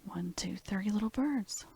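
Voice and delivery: a soft unvoiced whisper trailing into the count. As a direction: hush the numbers like a child is falling asleep. Bedtime counting of birds.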